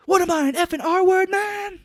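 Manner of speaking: very high, loud indignant yell; shrill hammed-up indignation; mock Cage outrage. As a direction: scream it like an offended lunatic. Cage yelling self-pity.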